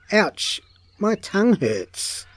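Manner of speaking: high pained ouch with whiny complaint; pained whine. A narrator's reading. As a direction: burnt tongue, whimper it. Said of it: "he thought".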